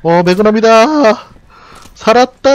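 high, slow, loud cheer of relief; excited shout. Real, not acted. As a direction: yell with joy at the find. Finding magnum, relieved in game.

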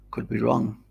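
ordinary talk, nothing marked

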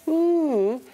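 high parrot-like hoot; parrot ooh. Retold or imitated. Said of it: "Doris was on his shoulder going".

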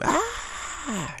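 short high mock scream; a quick hammed-up shriek of fright. Imitating women screaming at a reptile, high mock scream. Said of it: "and just scream".

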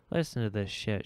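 plain reading voice

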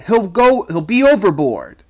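high, loud urgent shout. A narrator's reading. Sailor shouting to the crew to hold the boy, desperate and loud. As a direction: yell for help with all you've got. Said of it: "I shouted".

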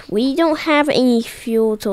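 usual speaking voice

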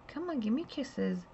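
coaxing pet talk. Retold or imitated. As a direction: sweet-talk the dragon for a kiss. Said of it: "I turned to her and I said".

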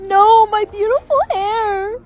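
slow, high, crying wail; wailing lament. Acted. Girl mourns her cut hair, wailing. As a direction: sob over the lost hair.

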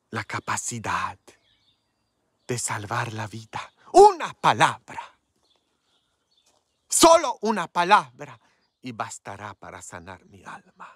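from hushed to a shout then back to a hush; preaching build. Real, not acted.

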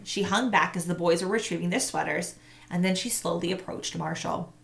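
plain reading voice

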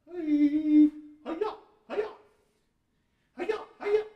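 loud high karate yells; effort cries. Acted. Dad straining in plank pose for the kids, yelling hi-ya. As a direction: belt out each hi-ya like a martial artist.

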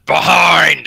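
high, loud orc chef shout; yelled kitchen warning. Acted. Orc chef warning goblins as he passes, yelling. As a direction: bellow it out across a busy kitchen. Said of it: "the orc is yelling out".

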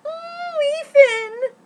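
high, loud fangirl squeal; excited squeal. Real, not acted. Gushing over her photo with Ethan, squealing. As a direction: squeal his name like a giddy fan.

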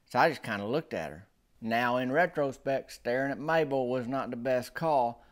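normal talking voice

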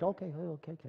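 hushed, quick backing-off; meek, soft acceptance; cowed agreement. Acted. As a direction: give in quietly to someone huge. Backing off from the giant's answer.

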